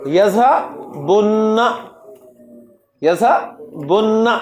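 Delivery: یذہبن said very slowly, the final نّ held long; teacher modelling یذہبن slowly with a long final نّ; drawn-out doubled nun. Shown as a demonstration. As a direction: hold the نّ of یذہبن. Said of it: "تو کیا بن گیا؟".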